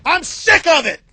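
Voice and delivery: loud, fed-up outburst; fed-up host yelling on air; angry rant. Real, not acted. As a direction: bellow it at the audience. Ranting host.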